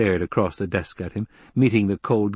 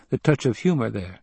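ordinary delivery

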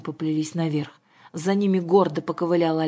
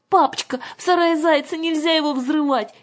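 put-on high, loud childlike plea; pleading little girl; 11 semitones higher, 5 dB louder than usual. A narrator's reading. Аннушка rushes to stop her father, alarmed pleading. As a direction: beg urgently in a high child voice. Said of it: "кинулась к нему Аннушка".

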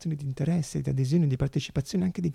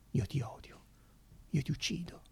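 whispered threat, much softer; hushed; 14 dB quieter than usual. Acted. Speaker quoting hateful words in a near whisper. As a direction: let it drop to a whisper.

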